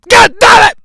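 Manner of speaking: mock angry outburst; shouted curse. Shown as a demonstration. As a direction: bark it out in frustration.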